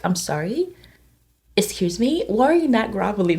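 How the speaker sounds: ordinary talk, nothing marked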